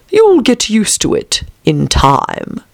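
dismissive caterpillar. A narrator's reading. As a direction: draw it out with languid indifference.

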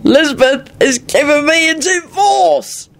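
put-on high, slow drunken announcement; triumphant. A narrator's reading. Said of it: "he pronounced triumphantly".

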